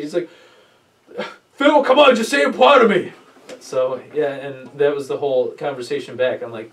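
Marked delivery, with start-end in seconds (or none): 1.5-3.1 much higher, louder begging; a hammed-up whining plea; whiny pleading. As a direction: whine for approval like a needy kid. Friend begging for praise.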